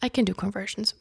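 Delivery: usual speaking voice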